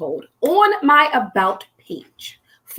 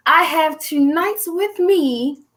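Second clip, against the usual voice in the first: slow, higher show-host build-up with a laugh; announcer build-up; 5 semitones higher, slower than usual. Acted. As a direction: draw out the drum roll like a host before the reveal. Announcing the guest.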